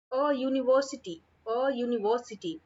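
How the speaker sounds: "university" opened as yu, with य; slow model reading, "A" as open अ before "university"; y-sound university. Shown as a demonstration. Teacher showing a before य sound, "university" said slowly as yu-niversity. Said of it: "य की sound आ रही है".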